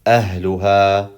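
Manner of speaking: ahluha in one slow, connected flow; connected ahluha, no pauses; joined word. Shown as a demonstration. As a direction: join ah, lu, ha into ahluha. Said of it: "vowel together would be these two letters".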